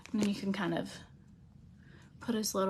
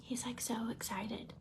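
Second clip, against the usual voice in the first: whisper; 9 dB quieter, slower than usual. Real, not acted. Whispering about purring cat.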